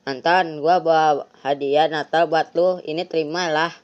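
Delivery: usual speaking voice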